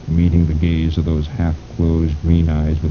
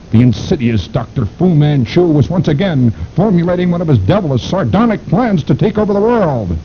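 climbing higher with each phrase; dramatic rising narration; 12 semitones higher, 3 dB louder than usual. A narrator's reading. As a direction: build the menace to a peak. Climactic villain narration.

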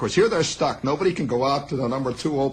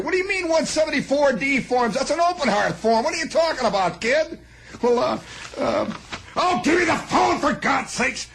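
exasperated boss; 10 semitones higher than usual. Retold or imitated. Mister Moss scolding the clerk over the wrong form, high exasperated voice. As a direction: scold the kid, fed up.

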